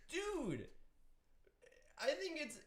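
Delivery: high-pitched mock offense; mock indignation. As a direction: play the outraged Irishman for laughs. Mock outrage as offended Irishman.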